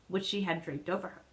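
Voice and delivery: usual speaking voice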